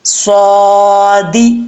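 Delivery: صادی full-mouthed and drawn out; slow heavy saad demo. Shown as a demonstration. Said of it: "full mouth پڑھنا ہے".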